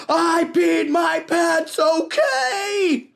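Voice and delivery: high, slow, blaring confession; blaring outburst. A narrator's reading. Peter exploding at his friends, high blaring yell. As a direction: blurt it out like a car horn. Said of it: "His voice blared like a car horn".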